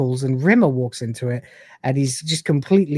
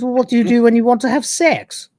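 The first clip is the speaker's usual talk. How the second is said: higher, lightly put-on regal voice; coy regal hostess; 7 semitones higher than usual. Hostess teasing Rimmer about sex, higher put-on regal voice. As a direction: tease him with a regal lilt. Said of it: "She goes".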